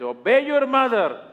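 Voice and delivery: high, loud command; mother laying down the law; a hint of a stern mother. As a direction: order him to obey.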